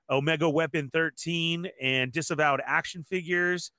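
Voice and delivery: ordinary talk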